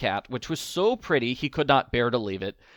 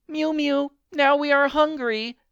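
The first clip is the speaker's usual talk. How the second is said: very high, squeaky cat voices; mewing cats; 4 dB louder than usual. A narrator's reading. Thirsty cats crying to the old man, high mewing. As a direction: mew like a crowd of kittens. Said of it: "said the hundreds of cats".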